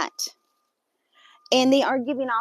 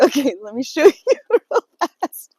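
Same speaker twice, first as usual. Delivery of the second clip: nervous laughing; 3 semitones higher, 10 dB louder than usual. Real, not acted. Nervous laughter while showing creature.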